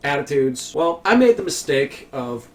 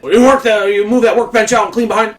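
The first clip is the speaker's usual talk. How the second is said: much higher and louder angry order; angry boss; 8 semitones higher, 9 dB louder than usual. Retold or imitated. Supervisor ordering the worker during the argument, high loud angry. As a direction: bark it up high like a furious supervisor.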